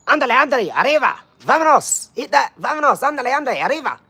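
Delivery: put-on high, rapid-fire Mexican-accent cheer; mock Mexican accent. Streamer playfully cheers Mexican viewers on, mock Mexican accent.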